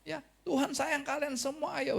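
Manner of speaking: high, pleading preacher calling people to repent; an old prophet's high coaxing call; Noah pleading. Retold or imitated. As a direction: beg the crowd to turn back, gently urgent. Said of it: "ya saya bahasakan khotbahkan".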